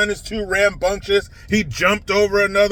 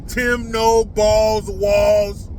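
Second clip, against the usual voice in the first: drawn out very slowly; mocking nickname; much slower than usual. Real, not acted. Host drags out a mocking nickname for Walz, very slow. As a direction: stretch the insult out slowly.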